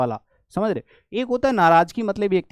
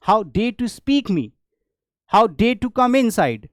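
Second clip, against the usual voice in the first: louder, slow outraged English; indignant how dare; 5 semitones higher, 4 dB louder, much slower than usual. Shown as a demonstration. Teacher modelling an outraged how dare you, slow and louder. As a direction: confront the intruder slowly. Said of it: "कैसे कहोगे?".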